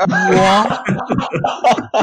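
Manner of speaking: drawn-out high what; hearing the dog ate nappies, long high disbelief; mock disbelief. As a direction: stretch it out in shock. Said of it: "I was like".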